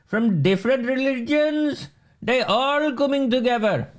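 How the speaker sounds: mock the councillor with an exaggerated accent, pitched well up; Chauhan in a bad accent. Quoting the mayor's church speech, in a mocking put-on accent. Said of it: "for my bad accent".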